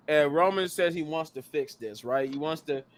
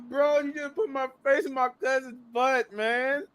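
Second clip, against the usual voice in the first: laughing complaint; 9 semitones higher than usual. Retold or imitated. Laughing retelling the botched spot.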